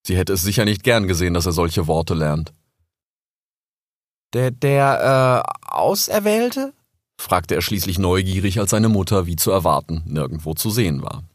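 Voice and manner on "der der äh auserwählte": high, hesitant little fox; young fox voice. Acted. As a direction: ask shyly like a small cub. Young fox Rix asks.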